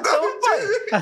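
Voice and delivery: laughing through the words; cracking up mid-sentence. Real, not acted. Retelling being dropped, laughing.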